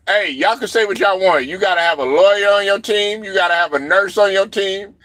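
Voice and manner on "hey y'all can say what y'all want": ordinary talk, nothing marked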